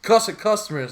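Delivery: scolding boss. Retold or imitated. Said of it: "She's like".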